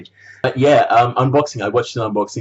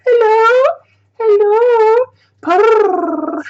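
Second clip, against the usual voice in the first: much higher and louder, cutesy kitten calling; 6 dB louder, much slower than usual. Pretend. Vlogger voicing the kitten coasters, high cutesy kitten. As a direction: coo it like a tiny kitten saying hello.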